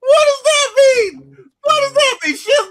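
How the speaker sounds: high, loud laughing disbelief; laughing outrage. Real, not acted. Arguing with his co-host, laughing in loud disbelief. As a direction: shout it through laughter, can't believe it.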